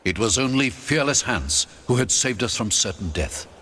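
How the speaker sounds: ordinary delivery, nothing marked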